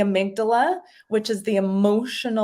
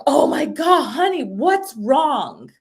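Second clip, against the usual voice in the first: louder, higher alarm, then slow soothing question; worried mom; 5 semitones higher, 4 dB louder, slower than usual. Retold or imitated. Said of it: "she's like".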